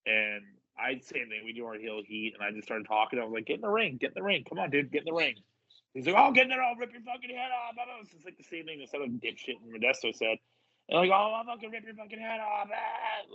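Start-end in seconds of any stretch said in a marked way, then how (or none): 3.5-5.3 higher, repeated goading challenge; retold taunt. Wrestler goading a heckler into the ring, retold taunt. 6.2-8.0 high-pitched imitation of a ranting heckler; mock angry bluster. Heckler threatening a wrestler, mocked angry bluster. 11.1-13.3 mock angry bluster. Heckler threatening to fight, mocked angry bluster.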